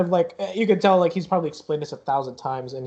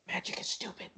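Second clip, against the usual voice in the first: very soft whispered grumble; dismissive whisper; 5 semitones higher, 14 dB quieter than usual. Acted. Eric dismisses magic to his companion, whispering. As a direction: whisper it scornfully.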